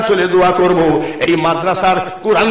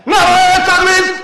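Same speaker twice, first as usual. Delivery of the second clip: very high, loud sustained cry; rallying slogan shout; 12 semitones higher, 6 dB louder than usual. Real, not acted. Preacher leading the takbir, high rallying shout. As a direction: shout it out to lead the crowd.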